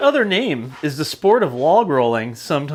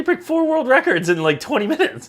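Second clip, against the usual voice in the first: high-pitched disbelief; can't believe the feat; incredulous; 9 semitones higher than usual. Real, not acted. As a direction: pitch shoots up in sheer disbelief. Disbelief at the record.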